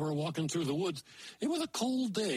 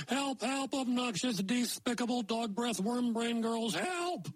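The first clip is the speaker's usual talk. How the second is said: much higher, desperate cries for help; panicked dwarf; 6 semitones higher than usual. Acted. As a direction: scream for help while still insulting. Dwarf caught by fish yelling.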